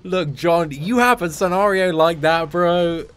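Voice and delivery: laughing. Real, not acted. Laughing, joking with chat.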